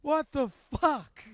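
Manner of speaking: high, slow, disbelieving; can't believe this happened; exasperated. Real, not acted. Cohost messed up audio levels.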